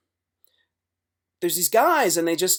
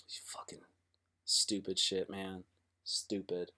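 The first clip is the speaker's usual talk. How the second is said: whispered, low and under the breath; muttered whisper; 8 semitones lower, 14 dB quieter than usual. Real, not acted. Comic cursing about blunt-buying friends in a low whisper. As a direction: grumble it to yourself in a whisper.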